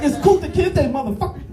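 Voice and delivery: high, hammed-up master voice; mock slave master. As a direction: insist on the name like a plantation owner. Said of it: "I'm like".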